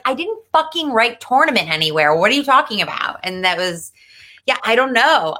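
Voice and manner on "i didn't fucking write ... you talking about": fast, indignant retort; mock outrage at mom's text; retold exasperation. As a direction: fire it back baffled and quick. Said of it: "And I was like".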